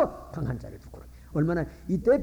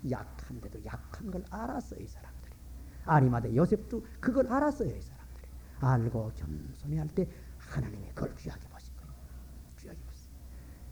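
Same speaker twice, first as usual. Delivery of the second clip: hushed preaching; 7 semitones lower, 8 dB quieter than usual. Real, not acted. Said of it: "softly stressed sermon point".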